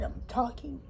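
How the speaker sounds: usual speaking voice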